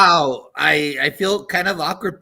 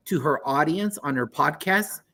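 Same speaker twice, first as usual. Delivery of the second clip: normal talking voice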